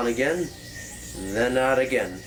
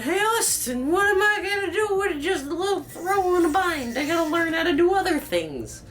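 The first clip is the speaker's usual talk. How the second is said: much higher, whiny asking voice; imagined viewer voice. Pretend. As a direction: pitch way up like a needy fan. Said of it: "I know y'all are asking".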